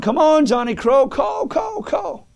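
high-pitched acted holler from overhead; hollering crows. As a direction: call out like crows cawing, pitch way up. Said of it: "Here comes the crows over hollering".

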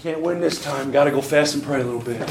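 low, trailing-off resignation; mock defeated Jesus. Preacher parodies a Jesus conceding to the devil, mock defeated. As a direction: give up meekly, voice sinking and softening. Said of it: "Show me where Jesus said".

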